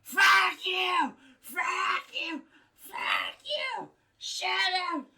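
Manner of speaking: very high, loud yelling out a window; furious mock scream. Neighbour at his window yelling at alley noise, screaming. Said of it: "scream at the top of his lung".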